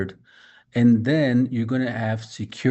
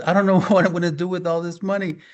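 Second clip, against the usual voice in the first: higher, louder and fast, laughing through the words; laughing with joy; 7 semitones higher, 5 dB louder, faster than usual. Retold or imitated. Retelling the overjoyed lady, laughing excitedly. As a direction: bubble over with laughing joy.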